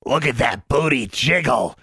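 slow, sly mocking creature voice; snickering creature. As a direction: snicker at her. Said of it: "snickered Honey".

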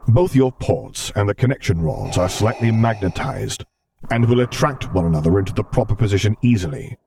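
calm assurance in a commanding voice; assuring. Acted. Vader reassuring Luke about the prosthesis, measured and commanding. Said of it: "he assured".

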